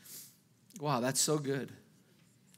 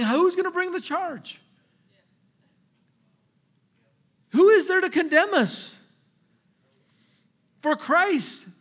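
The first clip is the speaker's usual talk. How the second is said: higher, louder, rising preacher's fire; full-force preaching; 8 semitones higher, 5 dB louder than usual. Acted. Preacher to congregation, calling out questions in full force. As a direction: drive every question home to the congregation.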